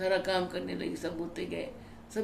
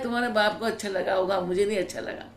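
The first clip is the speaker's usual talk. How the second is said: retold loud objection; 2 semitones higher, 6 dB louder, faster than usual. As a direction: retell an elder's brusque objection at raised volume. Retold objection to her name.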